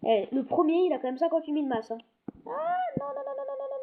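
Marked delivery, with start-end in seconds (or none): 2.5-3.8 panicked no. Real, not acted. About to get eaten in game.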